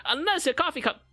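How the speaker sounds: teacher voice. Acted. Mocking a viewer's typo, as a high mock teacher. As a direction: play the pompous English teacher.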